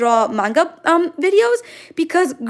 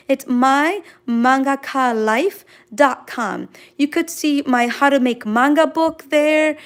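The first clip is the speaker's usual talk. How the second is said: ordinary talk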